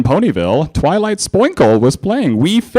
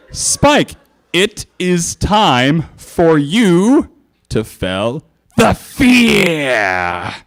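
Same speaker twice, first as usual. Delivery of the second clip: slow, drawn-out threat; menacing villain voice; 5 semitones higher, much slower than usual. Acted. Twilight threatening Spike, slow and menacing. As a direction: spell out the fear slowly like a cartoon villain.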